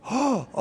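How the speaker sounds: high hammed-up shocked gasp; sharp mock gasp. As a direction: gasp as if scandalized. Shocked legalist hears swimming pool.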